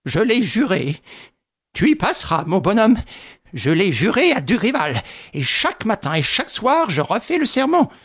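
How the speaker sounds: raging vow. A narrator's reading. Widow to Lupin, raging over her vow. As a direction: swear it with rage. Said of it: "trépignait de rage".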